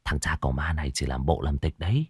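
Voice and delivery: low, soft murmur from the crowd; muttered gossip. Acted. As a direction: grumble it under your breath. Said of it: "tiếng xì xào".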